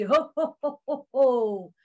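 hearty festive chuckle into a greeting; Santa Claus. Acted. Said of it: "And Santa was very happy".

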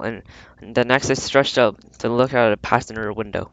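ordinary delivery, nothing marked

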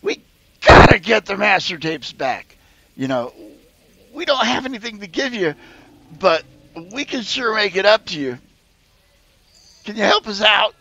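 high, eager little creature asking for help; squeaky creature hopeful plea; high pleading creature. Acted. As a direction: coax the party into helping.

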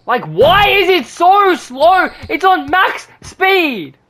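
high, loud, drawn-out complaint; exasperated slow shouted whine; frustrated whiny yell. Real, not acted. Frustrated at slow jump shot.

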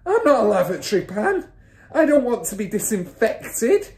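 loud, high indignant protest; husband's cry. A narrator's reading. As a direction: wail in outraged panic. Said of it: "the husband cried".

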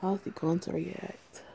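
usual speaking voice

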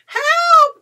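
high, loud shriek; cry for help. Acted. Witch flees the dragon, loud cry for help. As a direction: shout it high, calling for rescue. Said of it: "cried the witch".

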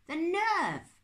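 high, loud indignation; an outraged uncle; indignant outburst. Acted. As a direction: spit it out, scandalised. Uncle Max indignant at Lucretia.

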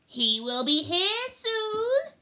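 high, slow, eerie witch croon; high witch's warning. Acted. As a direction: drawl it out like a sniffing old witch. Witch speaks in story.

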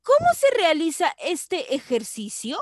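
loud, high question opening hard on "Cómo"; questioning tune. Shown as a demonstration. Teacher reading an exercise question to pupils, questioning tune. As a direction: ask it with a high, projected "Cómo". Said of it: "Otra pregunta".

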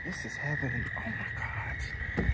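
hushed awe. Real, not acted. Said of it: "hushed awe".

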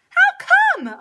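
very high, loud query; high startled cow voice; indignant cow. A narrator's reading. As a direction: yelp it as a nosy cow. Cow questions Pete indignantly.

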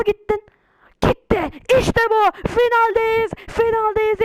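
high, loud triumphant cheer; real joyful shouting; excited cheer. Real, not acted. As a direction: celebrate making the final. Qualifying for the final.

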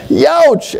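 mock pained wince; reacting to the iPhone price; youch. Real, not acted.

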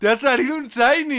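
loud, high yell of celebration; jubilant shout. Real, not acted. Friend to the lucky player, jubilant shout.

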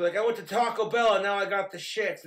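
ordinary talk, nothing marked

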